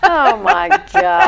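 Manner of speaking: laughing. Real, not acted. Laughing at goofy ponytail moment.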